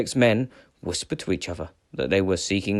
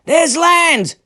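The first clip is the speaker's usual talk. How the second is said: Eric calls out loud and high to his crew; calling out; 8 dB louder than usual. A narrator's reading. Viking chief calls his crew to land, loud call. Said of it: "called out Eric".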